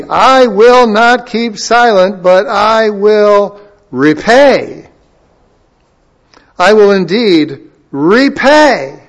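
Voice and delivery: loud, high, slow scripture proclamation; preaching at full force. Acted. Preacher proclaiming God's judgment to the congregation, loud and slow. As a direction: thunder out the Lord's warning, slowly.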